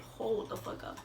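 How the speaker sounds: hushed, slow whisper of alarm; whispered hold the fuck up; shocked whisper. Retold or imitated. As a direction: breathe it out, stunned. Shocked at friend's foaming mouth.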